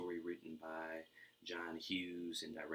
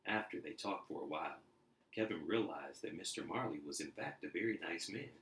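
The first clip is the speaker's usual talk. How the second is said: lively storybook reading; animated read-aloud for kids; story narration; 3 semitones higher, 4 dB louder than usual. A narrator's reading. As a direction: read it like a bedtime picture book. Reading picture book aloud.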